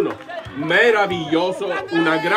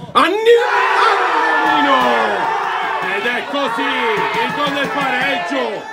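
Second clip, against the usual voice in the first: goal shout; 8 semitones higher than usual. Real, not acted. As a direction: stretch out the equaliser call. Late equaliser scored.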